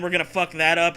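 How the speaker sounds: usual speaking voice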